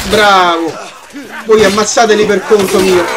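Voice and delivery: ordinary delivery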